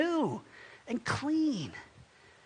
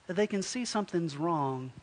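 ordinary talk, nothing marked

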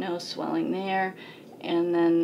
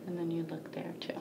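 whisper; 10 dB quieter, faster than usual. Real, not acted. Doctor's quiet aside.

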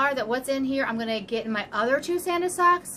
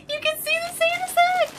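high, giggling, bursting with delight; giddy laughter. Real, not acted. Giddy unboxing of a gift.

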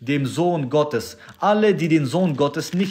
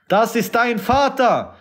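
raised, forceful repeat with Vater driven down at the end; preaching emphasis; 10 semitones higher than usual. Acted. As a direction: throw the line out like an accusation from the pulpit. Repeats line, then raises it.

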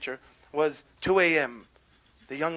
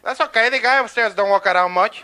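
loud, higher landlord character voice; loud, unbothered building owner; dismissive landlord; 4 semitones higher, 6 dB louder than usual. Acted. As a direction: brush off the tenant at full volume. Landlord brushing off the complaint.

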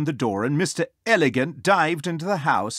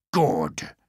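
wolf character; 5 semitones lower than usual. Acted. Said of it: "The wolf just had time to say".

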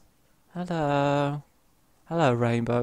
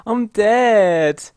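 louder, higher cry on dead; loud dismay; 8 semitones higher, 10 dB louder than usual. Real, not acted. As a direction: shout the news of your own death. Just got killed in game.

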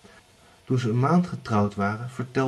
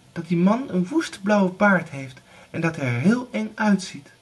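put-on high, louder girlish voice; spooked younger girl; 9 semitones higher, 5 dB louder than usual. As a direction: tell the scary rumour wide-eyed. Erika describes the beard.

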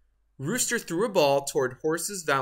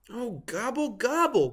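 groaning; 8 semitones higher than usual. A narrator's reading. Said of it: "groaned Turkey".

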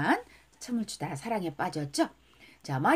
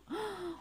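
sudden breathy intake, heart fluttering; mock swooning gasp; excited gasp; 10 semitones higher, 10 dB quieter than usual. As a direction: gasp as if struck by love. Acting out something in the heart.

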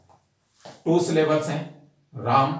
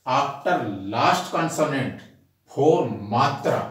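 very slow, word by word, pausing between phrases; dictating a rule; much slower than usual. Shown as a demonstration. Teacher dictating an English rule to students, slow and deliberate. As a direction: spell out the rule slowly so students can write it down.

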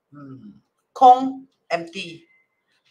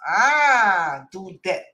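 normal talking voice

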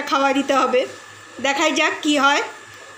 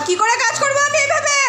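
exasperated; 11 semitones higher than usual. Real, not acted. Dog keeps barking during work.

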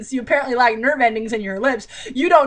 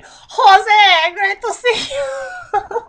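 much higher, slow, laughing greeting; laughing squeal; 10 semitones higher, 5 dB louder, much slower than usual. Real, not acted. Reader to viewers, laughing while reading the greeting.

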